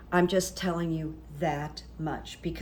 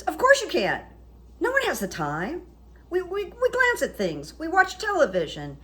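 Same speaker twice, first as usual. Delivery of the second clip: much higher, gushing agreement; mock eager Stigler; 10 semitones higher, 3 dB louder than usual. Publisher assuring Chance nobody reads, gushing higher voice. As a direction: rush to agree with him, high and eager.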